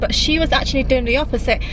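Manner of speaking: ordinary talk, nothing marked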